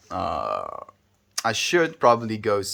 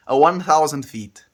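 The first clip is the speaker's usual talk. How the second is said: ordinary delivery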